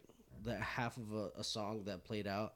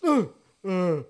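put-on louder, dazed drunk mumble; groggy drunk; 5 semitones higher, 11 dB louder than usual. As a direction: come to after a slap, groggy. Drunk friend woken by a smack.

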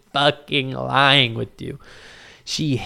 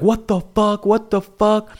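high-pitched imitation of a stunned crowd; mock bystander disbelief; shocked onlookers; 7 semitones higher, slower than usual. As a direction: put on a high, incredulous voice.

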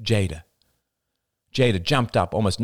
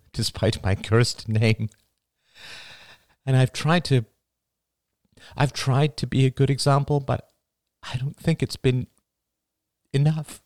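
faltering, higher voice; 7 semitones higher than usual. A narrator's reading. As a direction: voice wavering upward with emotion. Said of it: "Karen's voice faltered".

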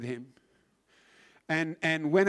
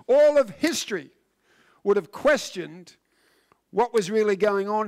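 preaching emphasis; 4 semitones higher, 7 dB louder than usual. Real, not acted. Preaching at full force.

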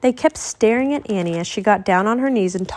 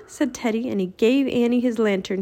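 plain reading voice

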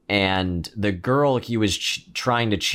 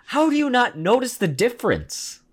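high, disbelieving question; can't believe it, laughing; incredulous; 12 semitones higher than usual. Retold or imitated. Incredulous reaction to movie scene.

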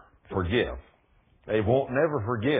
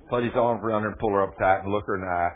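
ordinary talk, nothing marked

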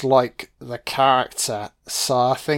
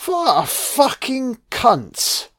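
disgusted outburst; 11 semitones higher, slower than usual. Real, not acted. Insulting the villain.

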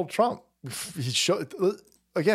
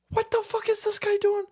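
higher mock outrage from the wife; wife's baffled reaction; 12 semitones higher than usual. Salt Queen watching her husband's stream, baffled and higher. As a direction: play the wife staring in disbelief, pitched up. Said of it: "sitting there going".

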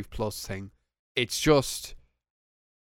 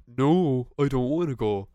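sarcastic; 4 dB louder than usual. Shown as a demonstration. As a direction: refuse so it's obviously a joke. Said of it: "I'm just being sarcastic".

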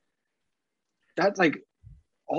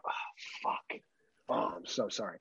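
canned sympathy; 5 semitones lower, 13 dB quieter than usual. Shown as a demonstration. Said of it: "That is my default canned".

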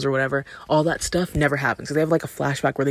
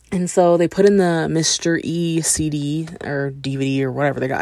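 ordinary talk, nothing marked

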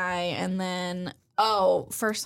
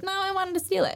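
cheeky admission; 11 semitones higher than usual. Retold or imitated. Cheeky thief admits theft.

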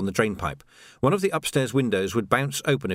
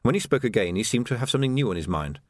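ordinary delivery

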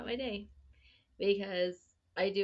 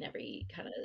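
whisper; 8 semitones lower, 6 dB quieter, faster than usual. Real, not acted. Trailing whisper.

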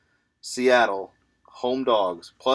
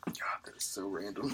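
whispering; 13 dB quieter than usual. Pretend. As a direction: whisper in disbelief. Whispers reaction to the pick.

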